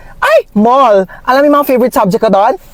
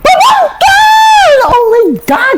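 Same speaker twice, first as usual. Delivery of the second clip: excited shout; 4 dB louder, much slower than usual. Acted. Tita's loud grand entrance.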